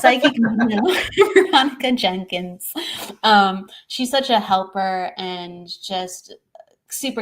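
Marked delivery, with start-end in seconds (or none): 0.0-2.7 laughing. Real, not acted. Laughing at own stumble.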